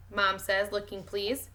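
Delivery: ordinary delivery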